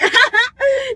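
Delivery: high, loud burst of laughter; real laugh. Real, not acted. Cracking up at her own slip of the tongue, real laugh. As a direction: crack up, pitch shooting up.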